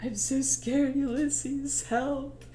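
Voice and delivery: soft, slow, scared plea; mock dying fear. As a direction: whimper like a dying man. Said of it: "he's like".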